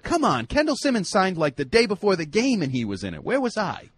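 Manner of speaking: high-pitched mock player protest; indignant Banta-Kane. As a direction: imitate an aggrieved player pleading his case. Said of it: "Banta-Kane said".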